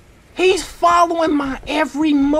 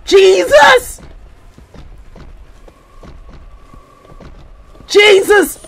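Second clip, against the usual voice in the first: loud, high exclamation; sudden panicked yell; startled shout; 5 semitones higher, 6 dB louder, slower than usual. Real, not acted. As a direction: cry out in alarm. Startled by pursuer in game.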